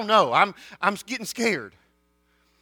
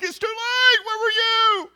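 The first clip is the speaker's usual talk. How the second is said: high, loud mock whine; whiny pained boy; 9 dB louder, slower than usual. Boy whining to his mom after the whipping, high mock cry. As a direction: complain high like a hurt kid. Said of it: "I'm like".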